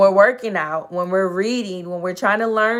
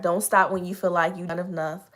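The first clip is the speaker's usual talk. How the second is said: ordinary talk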